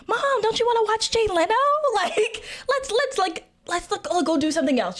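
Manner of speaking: high, fast, forced cheer; a kid chirping to steer mom away; nervous cheerful distraction. Retold or imitated.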